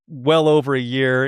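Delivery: normal talking voice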